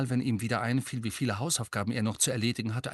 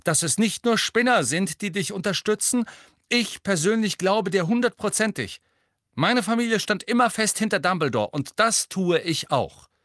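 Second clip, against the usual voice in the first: high and loud, projecting every word; loud carrying voice; 10 semitones higher, 5 dB louder than usual. A narrator's reading. Ernie speaks up to Harry, loud and carrying. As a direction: Ernie booming so the whole class hears. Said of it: "mit lauter, tragender Stimme".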